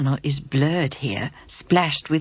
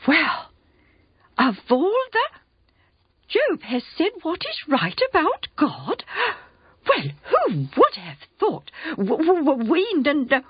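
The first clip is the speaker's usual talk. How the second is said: much higher, sputtering outraged friends; indignant muttering; 12 semitones higher than usual. Acted. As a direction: splutter in offended disbelief, higher. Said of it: "must have muttered among themselves".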